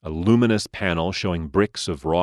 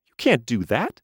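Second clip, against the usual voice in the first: high, protesting doll voice; the toy objects sharply; indignant doll; 6 semitones higher than usual. A narrator's reading.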